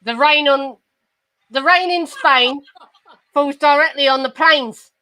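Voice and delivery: broad Cockney accent, deliberate phrases; Cockney elocution pupil reciting; careful, halting Cockney recitation; Eliza Doolittle. Shown as a demonstration. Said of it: "if we're doing Eliza Doolittle".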